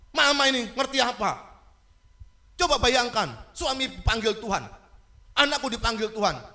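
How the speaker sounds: loud, high outburst for the grieving daughter; forceful preaching. Acted. Preacher voicing the daughter's grief, loud and high. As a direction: preach it as her anguish.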